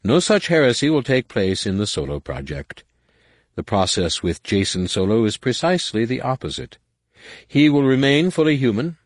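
confident, persuasive courtier; smooth schemer. Acted. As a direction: reassure the warlord smoothly. Nomanor voice.

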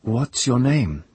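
high, slow, demanding question; peremptory. Acted. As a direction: demand it like an order. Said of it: "she asked, peremptory".

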